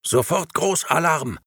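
sharp high alarm call. A narrator's reading. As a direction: raise the alarm. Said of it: "hörte sie rufen".

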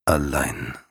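low rumbling growl of a stone lion; grinding rumbling rock voice. Acted. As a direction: growl the word from deep down. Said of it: "mit grollender Stimme".

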